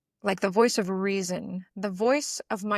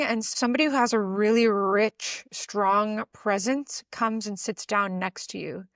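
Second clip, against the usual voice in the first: ordinary talk, nothing marked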